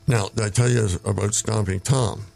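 usual speaking voice

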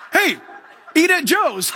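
high mock shark calling out. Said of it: "it means to a shark".

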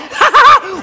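short burst of high, loud laughter; hammed-up helpless laughing; imitated cackle. Girlfriend still laughing at him, high loud cackle. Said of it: "she's gonna laugh and point".

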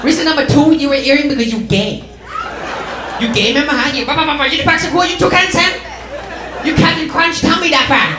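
outraged mom. Retold or imitated. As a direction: rant at the son like a scandalized mother. Mom voiced listing reasons.

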